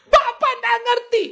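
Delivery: very high, stretched-out complaint; mock wailing protest. Upset wife protests that pastor cannot understand, mock wail.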